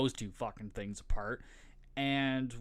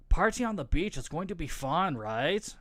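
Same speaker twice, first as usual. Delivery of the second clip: higher, louder mock party girl; imitated blonde girl; 5 semitones higher, 5 dB louder than usual. Blonde hyping the beach trip to her sister, imitated party-girl voice. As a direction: play up an eager blonde, pitched up. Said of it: "so the blonde goes".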